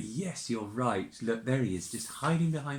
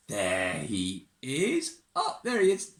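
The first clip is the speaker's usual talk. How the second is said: ordinary delivery, nothing marked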